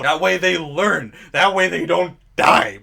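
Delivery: ordinary delivery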